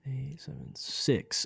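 hushed count under the breath; soft muttered countdown before the reveal; whispered countdown. Real, not acted. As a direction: whisper the numbers down to six. Counting down dice roll.

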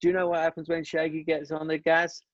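hammed-up Kenny driving the punchline to a shrill peak; American boss mocking the engine in front of the factory; exaggerated American accent. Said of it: "I won't do the accent 'cause I'm crap at accents".

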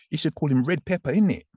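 high, put-on London accent with a tag question; local London accent. Imitating how Londoners talk about his nickname, London accent. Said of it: "That's how they talk".

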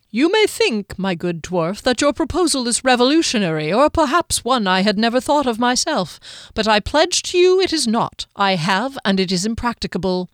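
theatrical, high-swooping florid lecture; courtly, flowery halfling. Acted. Halfling explaining his spell's limits to the dwarf, grandly courteous. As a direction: explain grandly with emphatic flourishes.

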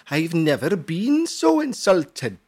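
hammed-up Welsh accent, affronted. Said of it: "or should I say in a Welsh accent".

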